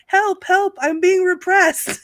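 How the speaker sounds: high, loud cry of help help; high mock yell, being repressed. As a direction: yell it like a peasant playing victim. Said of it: "he was yelling".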